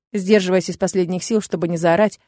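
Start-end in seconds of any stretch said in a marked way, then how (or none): none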